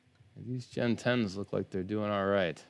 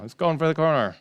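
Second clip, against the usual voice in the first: race caller; 7 semitones higher, 8 dB louder than usual. Acted. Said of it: "I feel like a horse race announcer right now".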